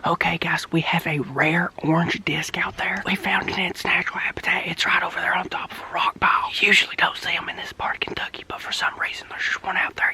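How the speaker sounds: hushed whispering hunter narration; nature documentary. Pretend. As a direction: whisper like stalking prey on camera. Whispered hunting-show narration.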